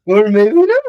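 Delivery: high and loud, hammed up; mock theatrical echo; high silly voice. Echoes the silly voice.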